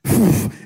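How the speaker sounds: sharp puff of escaping steam; steam burst. Retold or imitated. Machine steaming open after instructions went unread, imitated burst of steam. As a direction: blow out a short whoosh of air.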